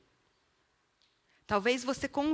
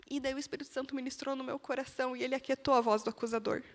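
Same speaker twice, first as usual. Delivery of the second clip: normal talking voice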